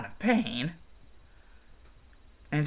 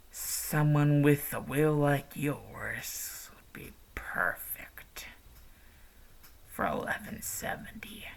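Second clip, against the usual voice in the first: villainous whisper; 7 dB quieter than usual. Acted. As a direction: whisper the plan to a helpless victim. Villain whispers his evil plan.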